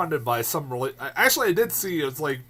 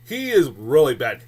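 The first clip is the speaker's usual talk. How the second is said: ordinary delivery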